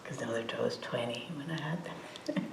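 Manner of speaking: breathy whispered joke, dropped right down; confiding whispered aside to the audience. Real, not acted. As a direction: whisper it behind your hand to the room. Joking aside about older kids.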